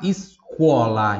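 escola said slowly and clearly, es-CO-la; slow teacher model of escola; slow model word. Shown as a demonstration. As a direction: spell out escola slowly for learners. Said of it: "Em voz alta".

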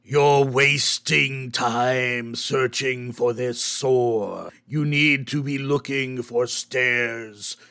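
low, slow, menacing whisper from a bag; sinister backpack voice. Acted. Voice from backpack speaks.

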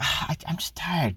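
breathy mock-tired whisper, unvoiced; whiny whisper. Mimicking wife refusing husband, whispered whine. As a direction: imitate a tired wife brushing him off in a whisper. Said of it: "y'all be like".

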